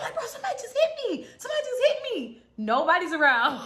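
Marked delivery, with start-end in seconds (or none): 0.1-2.4 high, fast, rattled protest; alarmed insistence. Retold or imitated. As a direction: insist urgently, pitch way up. Insists that someone hit her.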